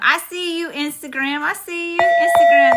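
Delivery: high, loud, thrilled greeting; excited shout-out. Real, not acted. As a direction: call out to the fans with joy. Shout-out to viewers by platform.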